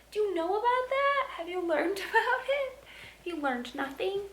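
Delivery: high sing-song scolding of the dog. Real, not acted.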